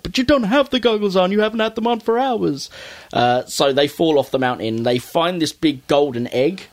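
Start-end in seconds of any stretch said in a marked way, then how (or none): 0.0-2.7 high, disbelieving cartoon kid voice; exasperated Cuphead. Cuphead telling Mugman he lost the goggles, in a high exasperated voice. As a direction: point out the obvious to the oblivious brother. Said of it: "He's like".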